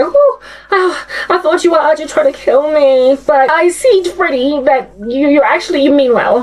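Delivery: a hint of a relieved teenage girl; relieved gullible girl. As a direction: sigh with relief like a fooled teenager. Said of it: "the teenage girl was like".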